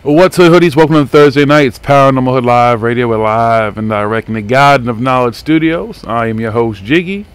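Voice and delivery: loud, punchy show-host welcome; hyped radio greeting. Acted. Host welcoming hoodies to the live radio, hyped announcer voice. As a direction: pump up the crowd at the top of the show.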